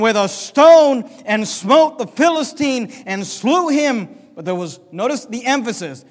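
loud, emphatic reading of scripture; preaching. Acted. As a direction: land the true verse with weight. Preacher reading the true verse.

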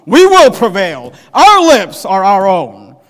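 slow, loud mock boast; hammed-up arrogant vow; proud boast. Preacher mimicking proud men boasting, slow and loud. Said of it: "men who speak with proud things".

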